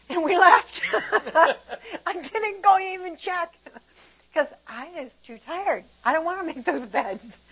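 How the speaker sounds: high, giggly admission; laughing confession. Real, not acted. Confessing she skipped checking the dryer, laughing. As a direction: tell it through real laughter.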